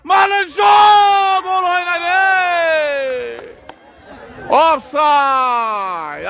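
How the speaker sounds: frantic football commentary. Real, not acted. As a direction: shout the shot, then stretch the word. Mbappe shot, offside.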